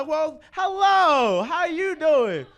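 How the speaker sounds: slow, high and loud flirting; host flirting with a woman in the audience, slow and high; flirty greeting. Real, not acted. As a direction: draw it out like a smooth pickup line.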